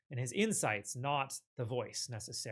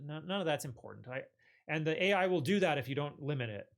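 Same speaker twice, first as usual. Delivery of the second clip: ordinary delivery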